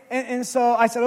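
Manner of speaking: ordinary delivery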